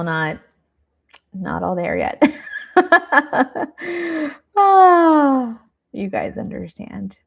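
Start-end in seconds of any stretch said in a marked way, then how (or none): 2.3-4.3 real high, rippling laugh; self-amused laugh. Real, not acted. Laughing at her own brain lapse. 4.6-5.6 loud, high "aah" after laughing; laughing sigh. Real, not acted. End of her laughing fit.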